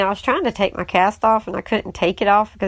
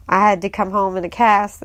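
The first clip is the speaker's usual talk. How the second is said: normal talking voice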